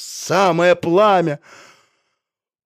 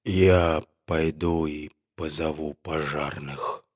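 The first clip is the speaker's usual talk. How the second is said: much lower, softer, weak voice; dizzy doctor speaking faintly to the animals; barely audible; 10 semitones lower, 5 dB quieter than usual. A narrator's reading. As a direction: speak with almost no breath. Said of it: "сказал он еле слышным голосом".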